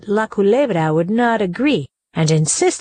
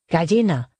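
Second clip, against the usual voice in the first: usual speaking voice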